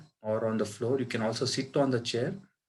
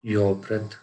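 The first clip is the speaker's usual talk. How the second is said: guided meditation. Acted. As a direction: guide them into calm, unhurried, phrase by phrase. Leads a breath meditation.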